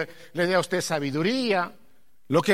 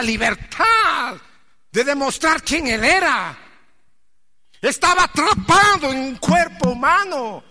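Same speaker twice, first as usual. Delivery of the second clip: high, loud fervent preaching; full-force preaching; 9 semitones higher, 7 dB louder than usual. Acted. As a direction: preach it out to the congregation. (predicación a todo pulmón)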